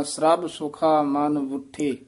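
ਸਰਬ said as srab in a slow model reading; slowly, joining s and r in ਸਰਬ; correct cluster. Shown as a demonstration. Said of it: "ਸਰਬ ਉਚਾਰਨ ਕਰਨਾ ਹੈ".

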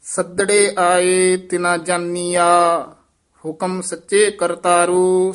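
measured, sustained Gurbani recitation; scripture recitation. Shown as a demonstration. Teacher reciting verse for students, sustained recitation. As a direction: hold the line endings long and even.

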